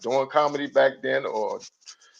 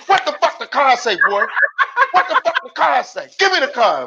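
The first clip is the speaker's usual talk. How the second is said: high, loud demanding yell; mock rage, grabbing for the card; furious sister; 11 semitones higher, 3 dB louder than usual. Sister Dana demanding the card from her brother, higher, louder mock yell. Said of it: "She said".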